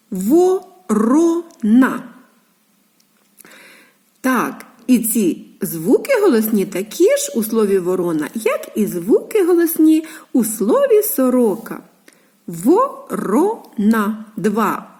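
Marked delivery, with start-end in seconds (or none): 0.1-2.0 "Ворона" very slowly in three separate syllables. Shown as a demonstration. Teacher showing three syllables to children, "Ворона" piece by piece. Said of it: "Три склади". 12.5-14.3 "Ворона" slow, each syllable on its own. Shown as a demonstration. Teacher pointing out vowels, "Ворона" split syllable by syllable. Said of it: "Три склади".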